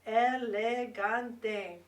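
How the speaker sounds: Elegante slowed into four clear syllables, e-le-gan-te. Shown as a demonstration. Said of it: "That's four syllables".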